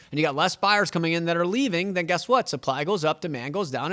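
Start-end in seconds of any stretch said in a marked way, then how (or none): none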